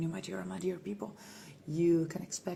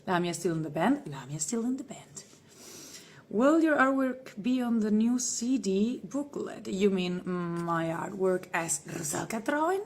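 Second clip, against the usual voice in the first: normal talking voice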